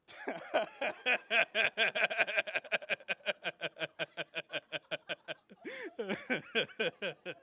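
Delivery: high, long ha-ha laughter fading down; drinking laughter. Shown as a demonstration. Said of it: "Drink your karak and laugh".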